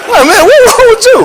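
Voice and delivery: high, laughing disbelief; laughing mock outrage. Comedian mocking a guy's dance, laughing outrage. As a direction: squeal it through laughter like you can't believe it.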